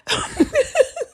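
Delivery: shrieking burst of laughter; high squealing laugh. Real, not acted. Vlogger cracking up at Zeke's denial, high squeal. Her own loud laugh.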